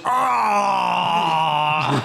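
short hammed-up cry of pain; mock pained yelp. Goro hit below the belt, short mock yelp. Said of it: "he goes".

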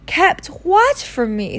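slow eager high question; eager Harry. A narrator's reading. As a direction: ask hungry to know. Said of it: "said Harry eagerly".

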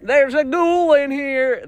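very high mock-terrified shriek; startled cry. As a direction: cry out in hammed-up alarm. Acted shock at finding a ghoul.

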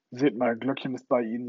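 usual speaking voice

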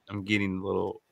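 ordinary talk

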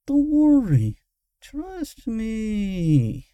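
high, stretched-out reassurance; drawn out. A narrator's reading. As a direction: draw each word out, pitched high. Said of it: "The last words were drawn out".

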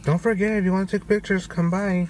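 high, quick imitation of the girl; girl's voice. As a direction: mimic her chirpy reminder. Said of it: "The girl's like".

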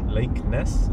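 lightly Scottish vowels in "Lake Ness"; hint of Scottish. Real, not acted. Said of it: "it almost sounds like you're trying to do a Scottish accent".

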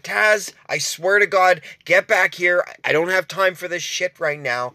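high, exasperated call after a runaway dog; angry yell at dog. Retold or imitated. Owner yelling after a runaway dog, high and fed up. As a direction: snap at the dog to get back here.

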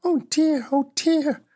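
put-on high, mildly dismayed little girl; a touch of childish alarm; dismayed cry. Dorothy sees the dead witch's feet, mildly dismayed. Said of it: "cried Dorothy ... in dismay".

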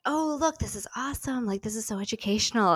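imitating spouse's delight. Wife quoting spouse praising baby's French toy, mock excitement. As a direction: gush like a proud parent over a toy. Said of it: "my spouse was like".

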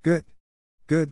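short approving cheer; crying out. A narrator's reading. Club member applauding the new member, crying out. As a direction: cheer with understated gusto. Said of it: "cried Joe".

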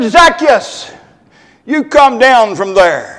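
loud, commanding call up into the tree; stern call. Retold or imitated. Jesus calling Zacchaeus down from the tree, stern and loud. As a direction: order him down like a firm parent. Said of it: "I don't know if he put it in that kind of tone of voice".

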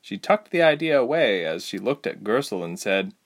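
ordinary delivery

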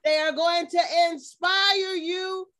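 slow, ringing and emphatic; rousing. Acted. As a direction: lift them up on every word. Building motivational outro.

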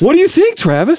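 high, breathless celebrity impression; Mark Wahlberg. Host asking his co-host, breathless Wahlberg voice. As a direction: ask it winded, pitched well up. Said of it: "have to act out of breath".

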